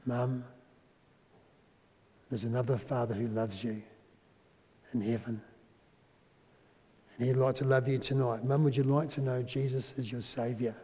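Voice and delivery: soft, gentle appeal to a grieving mother; tender invitation. Retold or imitated. Son gently invites mum to faith.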